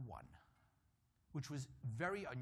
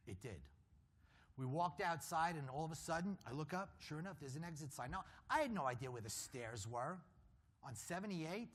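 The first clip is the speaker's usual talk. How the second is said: ordinary talk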